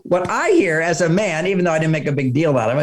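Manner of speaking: normal talking voice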